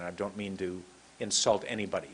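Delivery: normal talking voice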